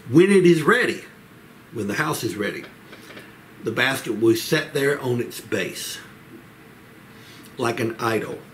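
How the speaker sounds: ordinary talk, nothing marked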